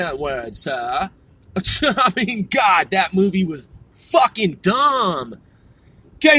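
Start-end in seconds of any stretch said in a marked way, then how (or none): 0.0-1.1 lower, softer, deadpan [name] drawl; lightly voiced [name], a bit lower and softer; [name] impression. Mocking his own pun as [name], lightly voiced. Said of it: "Now I sound like fucking [name]".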